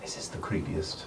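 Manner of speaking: hushed, breathy warning to the group; whisper. Acted. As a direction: keep it to a whisper, uneasy. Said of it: "Celine speaks up".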